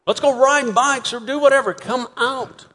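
put-on high, eager child's voice calling; excited kid at the door inviting a friend. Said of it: "this phrase".

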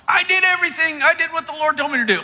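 high, loud self-justifying protest; hammed-up defensive outburst; mock protest. Imitating Saul's indignant protest.

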